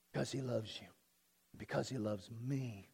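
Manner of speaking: very low, very soft, near-murmur; hushed, reverent reassurance; tender hush. Real, not acted. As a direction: drop right down and say it intimately. Preacher drops to tender aside.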